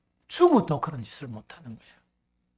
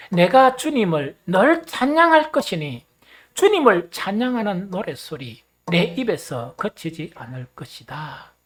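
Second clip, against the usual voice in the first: rally the congregation to recite louder; emphatic verse recitation; 3 semitones higher, 9 dB louder than usual. Real, not acted. Repeats verse after "once more, begin. (높고 우렁차게 성경 낭독)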